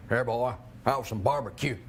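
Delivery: mock-manly Southern voice; manly Southern accent. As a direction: play a burly Southern man. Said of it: "the manly version of your Southern accent".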